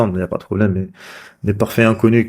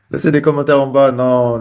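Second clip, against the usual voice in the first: laughing through it, a touch higher; 4 semitones higher than usual. Real, not acted. Vlogger laughing as he begs viewers for comments. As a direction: giggle while asking for comments.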